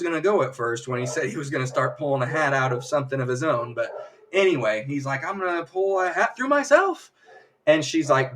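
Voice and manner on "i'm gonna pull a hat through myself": higher, climbing to the last word; lightly hammed-up magician's boast; showman's announcement. Magician declares his trick to the audience, showy rising voice.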